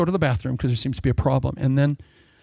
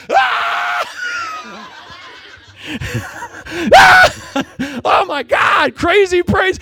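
high, loud shout of awe with laughter; amazed shout; 6 dB louder, much slower than usual. Retold or imitated. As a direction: cry out in wonder at the lights. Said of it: "and I went".

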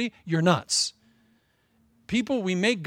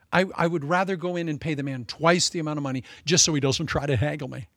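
ordinary talk

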